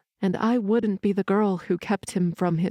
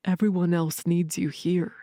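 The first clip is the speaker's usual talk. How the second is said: usual speaking voice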